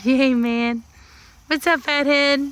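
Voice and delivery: high playful doggy talk. Real, not acted. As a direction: tease the dog fondly in a high coo. Greeting dog in baby talk.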